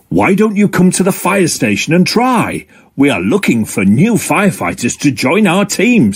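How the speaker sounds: lightly voiced fire dog, mildly stern; Frankie the fire dog. Frankie invites Octo.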